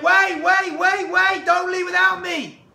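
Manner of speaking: high, loud pleading shout, slowing at the end; desperate calling out. Retold or imitated. Little Moshe chasing the man on horseback, loud and pleading. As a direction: yell after him not to go.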